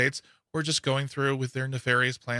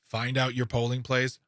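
usual speaking voice

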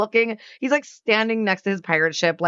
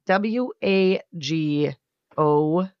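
spelled out slowly; 3 semitones lower, much slower than usual. Shown as a demonstration. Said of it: "text in the word wagon".

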